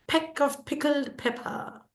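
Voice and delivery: high, soft "Peck of pickled pepper", p's puffed; repeated p sound. Shown as a demonstration. Teacher hinting at alliteration, soft popped p's. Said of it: "pa sound is repeated".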